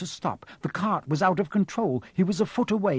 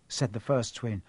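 ordinary delivery

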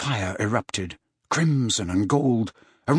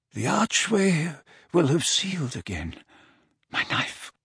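put-on softer, fading frail old man; weak slurred voice; 5 semitones higher, 3 dB quieter than usual. A narrator's reading. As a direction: struggle to get each word out. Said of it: "how slurred Dumbledore's voice".

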